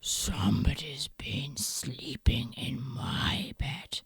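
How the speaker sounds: low whispered gruff bear; deep hushed papa bear; Daddy Bear. Acted. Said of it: "said Daddy Bear".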